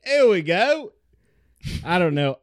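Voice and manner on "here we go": high Aussie exclamation; hammed-up Australian accent, pitched way up; mock Australian accent. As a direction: toss it out in a put-on Australian voice. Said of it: "how those people talk".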